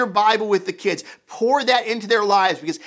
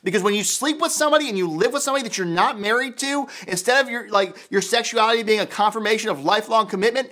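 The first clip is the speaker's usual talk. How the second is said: normal talking voice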